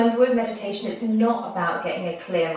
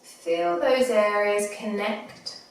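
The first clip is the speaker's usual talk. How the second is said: guided meditation. Acted. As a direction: ease them gently into the breath. Leads a body scan meditation.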